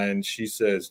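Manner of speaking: normal talking voice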